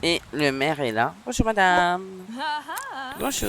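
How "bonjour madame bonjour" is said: singsong greeting. Pretend. Greets the mayor in the game.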